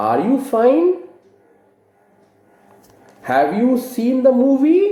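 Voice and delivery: "fine" and "movie" climbing high at the end; teacher's model questions with a clear high rise; rising intonation. Shown as a demonstration. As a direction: demonstrate a question tune, lifting to "fine" and "movie". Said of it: "remember to use the rising intonation".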